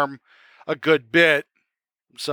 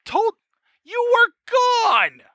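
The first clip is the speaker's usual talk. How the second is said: high, slow incredulous reply; indignant disbelief. Acted. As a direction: act shocked they even ask. GM as creator, indignant.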